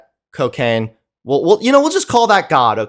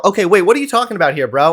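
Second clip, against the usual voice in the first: mocking rushed question; 3 semitones higher, much faster than usual. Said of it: "Nick tried to start getting in there".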